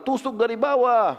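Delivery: high, slow, laughing command; laughing order. Acted. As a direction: give the order while laughing. Bilal's order, told laughing.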